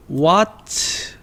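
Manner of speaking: each sound drawn out slowly; slow careful English teacher read; slow pronunciation. Shown as a demonstration. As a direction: stretch the word what out for learners. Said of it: "발음 좀".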